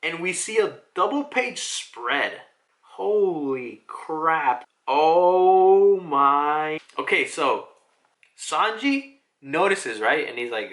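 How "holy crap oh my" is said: loud, drawn-out amazement; awestruck. Real, not acted. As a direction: stretch it out, loud and stunned. Awestruck at double page spread.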